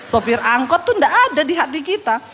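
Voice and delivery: normal talking voice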